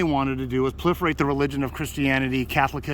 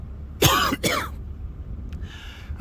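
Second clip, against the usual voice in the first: hacking cough from a swallowed bug; hard coughing fit. Real, not acted. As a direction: cough out the gnat. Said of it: "I just swallowed a gnat".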